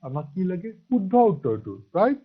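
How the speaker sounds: ordinary talk, nothing marked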